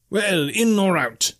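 high, drawn-out barkeep's call. A narrator's reading. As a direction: call across the tavern, slow and high. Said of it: "he shouted".

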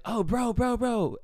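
high, hurried-sounding mock alarm; urgent interruption. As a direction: cut in to grab their attention. Acted urgent diversion.

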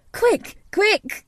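put-on very high urgent boy's cry; piping, impatient excitement; excited. A narrator's reading. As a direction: hurry him along eagerly. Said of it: "digging excitedly".

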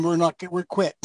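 ordinary talk

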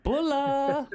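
bright, high call of hello; excited greeting. Real, not acted. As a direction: hail the mates with a joyful shout. Hearty greeting shouted in.

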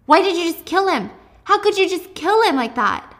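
mock horrified Juliet shouting; upset accusation. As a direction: demand it, voice climbing.

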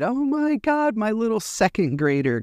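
high, gushing delight; delighted exclamation. Retold or imitated. A parent gushing over her new second grader, delighted. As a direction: gush over him like a proud parent. Swinging son around, delighted.

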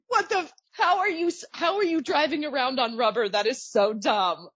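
high, incredulous outburst slowing at the end; mock exasperation. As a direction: act baffled and scornful. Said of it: "And I'm just like".

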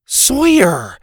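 loud high cut-off call; sharp call. A narrator's reading. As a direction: stop him short. Susie interrupts.

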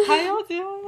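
high, laughing mock greeting; TikTok sound voice. Friends meeting awkwardly, laughing through a TikTok sound. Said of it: "That TikTok sound".

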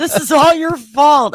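high, loud accusing wail; acted stoned hysteria; mock freak-out. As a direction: blame them in a hammed-up panic. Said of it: "I'm freaking out to the point saying".

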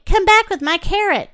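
high storybook call of dismay; crying out. A narrator's reading. Great Pig calling after Pegasus, crying out. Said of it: "cried the Great Pig".

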